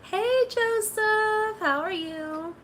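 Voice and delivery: high, very slow and drawn-out; sing-song greeting. Pretend. Greeting viewer Joseph with a high drawn-out sing-song. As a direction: stretch the hello out playfully.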